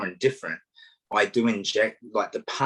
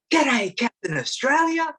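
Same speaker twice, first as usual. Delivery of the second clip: put-on high, slow little-kid voice; schoolkid greeting; 4 dB louder, slower than usual. Schoolkids greeting the costumed walker in a high kid voice. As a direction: chirp it up high like a small schoolkid. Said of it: "kids will go".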